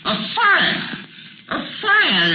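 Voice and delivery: high, indignant outburst; scandalised at the waste; outraged. Acted. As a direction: miser outraged at a fire. Scrooge outraged at coal use.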